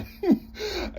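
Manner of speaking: a quick laugh breaking out; short high laugh. Real, not acted. Laughs while describing his house.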